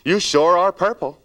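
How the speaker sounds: loud high mock remark; mock judgmental. Mock stylist fixated on colour.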